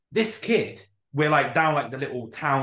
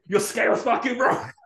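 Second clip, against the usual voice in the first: half-joking angry protest, a bit higher; mock angry; 7 semitones higher, 3 dB louder than usual. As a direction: pretend outrage at the scale. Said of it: "You're proper angry".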